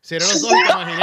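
words broken by real laughter; chuckling through the line; laughing while picturing him sob. Real, not acted. Host laughing at crying image.

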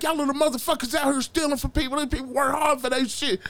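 high-pitched, indignant rant; angry telling-off. Retold or imitated. Neighbor scolding kids for stealing from people, angry telling-off. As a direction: tear into them, voice pitched high.

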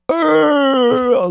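high, held strangled groan; mock dying groan. Host mimicking someone who starves to death, mock groan. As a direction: imitate a starving man keeling over. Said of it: "and go like".

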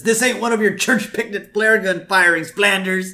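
puffed-up cartoon self-importance; Homer scolding. Castaway grabbing the flare gun from his neighbor, blustering. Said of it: "Homer takes it away from him".

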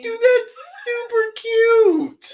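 high, drawn-out, loud squeal; gushing delight. Real, not acted. Host admiring friend's handmade hat, delighted squeal.

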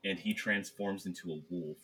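ordinary talk, nothing marked

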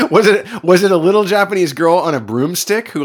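laughing high question. Real, not acted. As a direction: laugh through the words.

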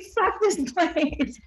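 high, very slow retold curse; slow mock fury. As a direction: drag out the curse in mock anger. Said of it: "I was pissed".

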